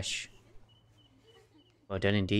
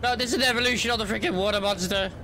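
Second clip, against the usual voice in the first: normal talking voice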